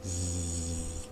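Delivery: soft buzzing zzz to show voicing; quiet vibrating zzz demo. Shown as a demonstration. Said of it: "berarti dia getar".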